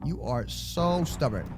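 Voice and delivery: ordinary talk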